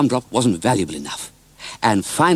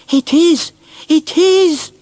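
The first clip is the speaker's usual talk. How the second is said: put-on much higher, loud child cry; boy's excited shout; 7 dB louder, much slower than usual. Robert answering Mister Oldcastle, high joyful shout. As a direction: shout it with joy. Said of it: "shouted Robert in turn".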